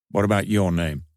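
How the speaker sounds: plain reading voice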